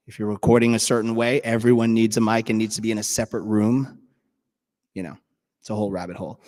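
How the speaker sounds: ordinary delivery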